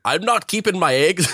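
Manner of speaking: high, laughing Irish lilt, hammed up; mock Irish accent. Podcaster imitating actress Saoirse on a work call, mock Irish accent. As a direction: giggle through a bad Irish accent.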